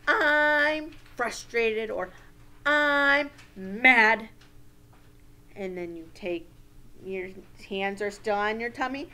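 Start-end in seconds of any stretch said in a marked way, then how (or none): 0.1-1.9 loud, high, slow mock frustration; acted frustration. Shown as a demonstration. Teacher showing children how to name feelings, acted frustration. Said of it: "name your feelings". 2.6-4.3 acted anger. Shown as a demonstration. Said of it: "name your feelings".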